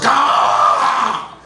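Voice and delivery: high breathy yelp of alarm; mock startled cry. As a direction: yelp as a bird swoops in. Birds mobbing him for hot dog.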